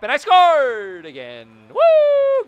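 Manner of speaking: high, loud celebration; triumphant whoop. Real, not acted. Gamer celebrating his latest goal, loud whoop. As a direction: whoop like you just won. Scores again.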